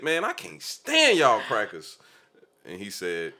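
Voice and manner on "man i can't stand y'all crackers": angry retort. Retold or imitated. Cursing out the judge in court.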